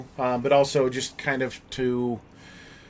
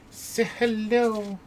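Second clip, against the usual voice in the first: slow, high sing-song coaxing; talking to a dog; 8 semitones higher, slower than usual. Real, not acted. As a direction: coax the dog sweetly.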